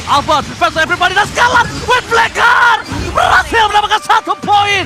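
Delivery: loud, high hype call; fighting game announcer. Acted. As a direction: belt it out like an arena caster. Caster hypes a kill.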